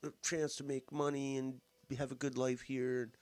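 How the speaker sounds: high-pitched mimicry of a politician's pitch; mocking politician impression. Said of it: "the way he talks is like".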